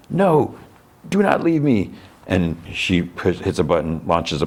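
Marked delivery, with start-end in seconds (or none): 0.1-1.9 high pained begging; anguished plea. Retold or imitated. Alexei pleading with Yelena not to leave him, high and pained. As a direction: beg her not to leave.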